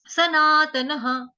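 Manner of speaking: ordinary talk, nothing marked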